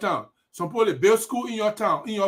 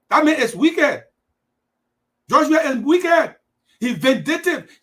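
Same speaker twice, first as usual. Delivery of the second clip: angry denunciation; 5 semitones higher, 6 dB louder than usual. Real, not acted. Denouncing the president angrily.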